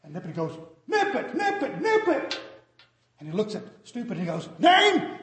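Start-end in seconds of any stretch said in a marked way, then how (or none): 0.9-2.4 very high, loud cartoon yell; shouted nip it. Shouting to half-deaf sergeant. 4.6-5.2 loud, high desk sergeant call; sergeant barking a question. Acted. Sergeant asks again.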